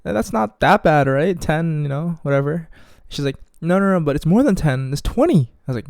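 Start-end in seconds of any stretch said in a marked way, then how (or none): 0.0-2.7 high-pitched fake casualness; uneasy brush-off, pitched up; forced nonchalance. Acting out forced nonchalance after shock. 3.6-5.5 high mock correction; put-on girl voice, rising very high; imitating the girl. Voicing the girl's bigger confession.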